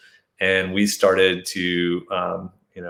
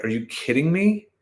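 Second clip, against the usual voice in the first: higher, exasperated outburst; retold rage; 7 semitones higher, 3 dB quieter than usual. Man recalling his rage at the searchers, disbelieving. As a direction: let the disbelief rise at the end. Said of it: "this rage of like".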